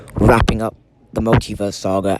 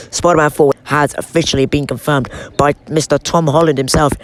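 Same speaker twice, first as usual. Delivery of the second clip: ordinary talk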